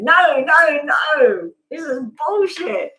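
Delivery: a lightly hammed-up angry brain refusing to calm down; angry inner voice. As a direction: blurt out your frustration at the breathing. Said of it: "your brain's going".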